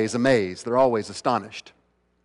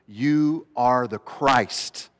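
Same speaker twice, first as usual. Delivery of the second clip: YOU ARE THE CHRIST very slow, each word weighted; powerful declaration; 3 semitones lower, much slower than usual. Retold or imitated. Peter answering Jesus, "You are the Christ" slow and powerful. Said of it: "so succinctly but so powerfully".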